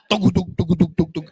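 nervous heart thumping out loud; heartbeat imitation. Shown as a demonstration. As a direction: mimic a pounding heartbeat, thumping out each beat. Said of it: "iba yung tibok ng dibdib mo nun".

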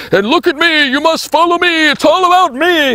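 high, squealing show-off voice; hammed-up, high-pitched plea for followers; mock self-promotion. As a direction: cry out for attention in caricature. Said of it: "I don't want to speak and say".

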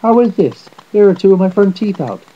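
high, loud outraged complaint; shouting. A narrator's reading. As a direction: shout it in pained disbelief. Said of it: "he shouted".

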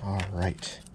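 usual speaking voice

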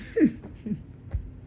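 short breathy chuckle; laughing. Real, not acted. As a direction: let out a brief disbelieving laugh. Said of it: "I'm not laughing because it's funny".

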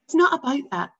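quickly. A narrator's reading. Boy rushing to reassure his father, high and loud. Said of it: "said Bruno quite quickly".

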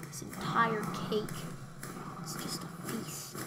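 breathy awed whisper about the cake; soft whispered awe. Acted. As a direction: hush it like a secret. Gazing at the cake, hushed.

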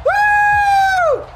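very high, loud falsetto whoop; high celebratory woo. Real, not acted. Gamer celebrating a big play, piercing high whoop. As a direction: whoop it out long.

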